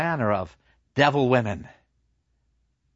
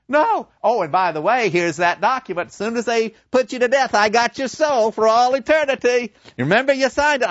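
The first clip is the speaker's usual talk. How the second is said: higher, louder, savouring the contract; gloating devil; 10 semitones higher, 4 dB louder than usual. Acted. Devil reminding Faust of the signed soul, gloating. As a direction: rub his nose in the deal.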